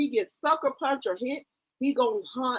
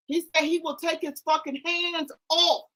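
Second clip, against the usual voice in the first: usual speaking voice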